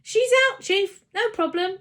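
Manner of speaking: high, loud call across the house; shouted call. A narrator's reading. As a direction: shout the good news to the chief. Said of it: "shouted out".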